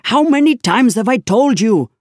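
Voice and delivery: high, loud furious scolding; angry. Acted. Inspector berating constable, high furious scolding. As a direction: berate him at the top of the voice. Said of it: "he cried angrily".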